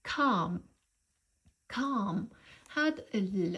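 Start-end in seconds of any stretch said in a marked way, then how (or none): 0.1-2.2 calm said with a long open British ah and a silent L; pronunciation model. Shown as a demonstration. Teacher modelling calm for learners, long British ah, silent L. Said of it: "الرمز هو صوت ال آ بالبريتش accent".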